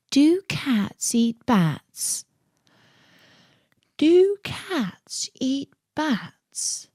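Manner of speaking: very slow, dreamy and drifting. A narrator's reading. Alice dozing off, repeating herself in a slow dreamy murmur. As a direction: say it like you're nodding off. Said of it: "in a dreamy sort of way".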